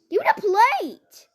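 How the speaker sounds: high, loud royal order; escalated queenly demand. Retold or imitated. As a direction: insist harder, shouting for the plate.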